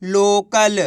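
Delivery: लोकल drawn out syllable by syllable; blended reading. Shown as a demonstration. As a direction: join the sounds slowly into one long लोकल. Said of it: "कैसे पढ़ेंगे". (लोकल को धीरे, खींचकर पढ़ते हुए)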